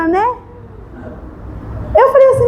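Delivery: normal talking voice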